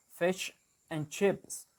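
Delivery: slow New Zealand 'fish and chips', shifted i in FISH; New Zealand accent. Shown as a demonstration. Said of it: "in New Zealand, they will say, like".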